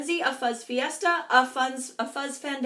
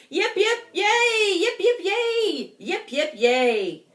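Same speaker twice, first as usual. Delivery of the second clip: high, loud yips and cheers; the crowd cheering Big Bark; 9 semitones higher, 6 dB louder, slower than usual. Acted. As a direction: cheer for the hero. Three cheers.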